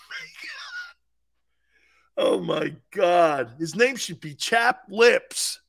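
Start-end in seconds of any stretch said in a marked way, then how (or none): none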